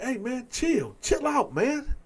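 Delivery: high-pitched plea to chill; retold, voice jumping up in protest; urgent protest. Man to his attacker, urgently protesting.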